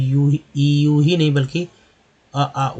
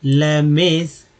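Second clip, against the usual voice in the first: slow model; much slower than usual. Shown as a demonstration. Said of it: "next देखिए layman's".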